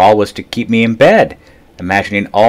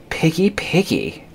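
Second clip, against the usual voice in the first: complaining; 10 semitones higher, 5 dB quieter, slower than usual. A narrator's reading. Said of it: "Herbert complained".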